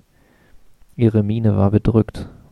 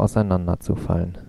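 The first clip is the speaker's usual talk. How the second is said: ordinary delivery, nothing marked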